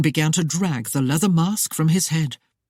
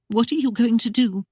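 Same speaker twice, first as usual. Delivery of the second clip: higher, afraid; trembling; 7 semitones higher than usual. A narrator's reading. Claudia asking Christopher with a sword at her, higher afraid voice. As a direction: ask in a faltering, scared voice. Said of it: "Claudia's voice trembled as she asked".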